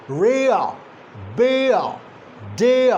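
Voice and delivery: "rea, bea, dea" gliding from "eh" to "uh"; diphthong drill. Shown as a demonstration. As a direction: model "rea, bea, dea" one by one, r dropped. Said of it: "that's the second".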